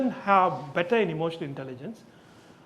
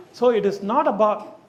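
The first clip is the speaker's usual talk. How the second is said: usual speaking voice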